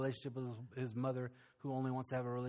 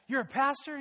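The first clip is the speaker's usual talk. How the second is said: surprised; 12 semitones higher, 8 dB louder than usual. Retold or imitated. Woman surprised he is a pastor.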